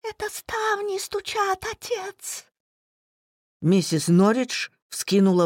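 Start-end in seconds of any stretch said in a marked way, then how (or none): 0.0-2.4 put-on high, tender young woman's voice; sweet innocent daughter, much higher; gentle girlish voice. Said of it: "ответила нежным голоском".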